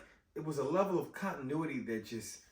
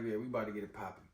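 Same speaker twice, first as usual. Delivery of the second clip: low, soft and fast, eager to fight; lightly voiced eager hero; low, quick hype line; 8 semitones lower, 7 dB quieter, much faster than usual. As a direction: rush it out like you're ready to scrap.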